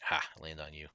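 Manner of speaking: normal talking voice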